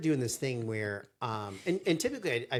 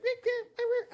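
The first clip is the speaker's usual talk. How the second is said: very high beeping noise; mock beeping. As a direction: squeak out a string of beeps. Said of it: "I'd be like".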